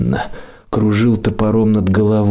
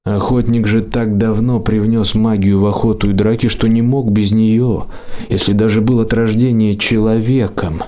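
usual speaking voice

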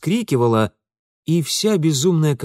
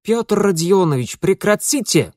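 put-on higher female voice, light German accent; cried with German accent; 7 semitones higher, 4 dB louder than usual. A narrator's reading. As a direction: protest sharply with a slight German accent. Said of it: "вскричал женский голос с лёгким металлическим акцентом".

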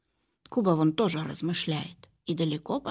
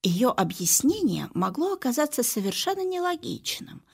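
ordinary delivery

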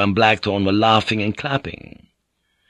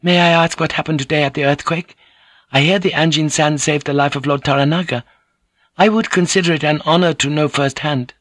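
politely innocent, in a higher voice; innocently; 6 semitones higher than usual. A narrator's reading. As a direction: ask with mild, innocent curiosity. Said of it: "she said innocently".

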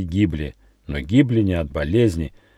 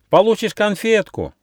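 высокий детский голосок; помани тонко, как ребёнок; кричащие дети; 14 semitones higher, 3 dB louder than usual. A narrator's reading. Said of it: "кричали дети". (детский выкрик высоким голосом)

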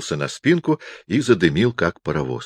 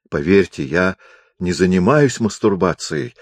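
ordinary delivery

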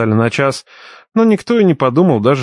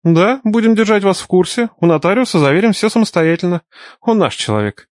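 stern; 7 semitones higher than usual. A narrator's reading. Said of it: "сурово кивнул Андрей".

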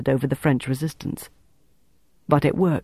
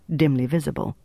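usual speaking voice

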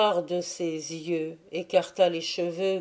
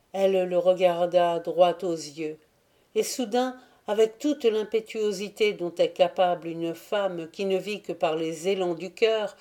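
ordinary delivery

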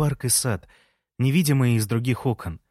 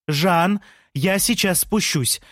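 shouting down; 6 semitones higher, 3 dB louder than usual. A narrator's reading. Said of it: "закричала Лея".

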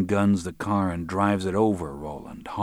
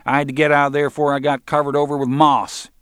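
Roland; 7 semitones higher, 6 dB louder, faster than usual. A narrator's reading. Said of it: "drawled in a high voice".

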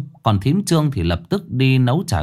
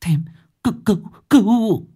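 high, faint gasping cry for help; terrified girl collapsing as she begs; breathless plea; 9 semitones higher than usual. Acted. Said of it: "nói không thành tiếng".

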